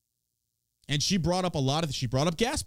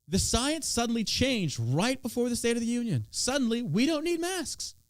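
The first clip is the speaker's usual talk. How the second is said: high, mock-amazed sarcasm; sarcastic; 7 semitones higher than usual. Real, not acted. Streamer sneering at convenient mask rule change, higher sarcastic voice.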